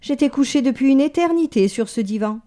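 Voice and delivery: mild pampered moan; complaining. Acted. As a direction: grumble about being stiff. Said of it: "se plaignit-elle".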